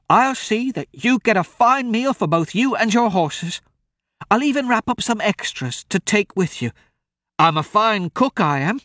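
put-on high, coaxing old woman's voice; an old farm wife bargaining with a meal. Old woman offers the travellers food for help.

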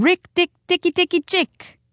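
high, chattering animal battle cry; mongoose war cry. A narrator's reading. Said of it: "go off into his long war cry".

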